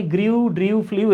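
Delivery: গ্রিউ, ড্রিউ, ফ্লিউ each with an "ee-oo" glide; habitual mispronunciation. Retold or imitated. Student admitting old reading habit, mispronounced গ্রিউ, ড্রিউ, ফ্লিউ with "ee-oo". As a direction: put gree-oo, dree-oo, flee-oo into গ্রিউ, ড্রিউ, ফ্লিউ. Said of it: "এভাবে পড়ে আসছি".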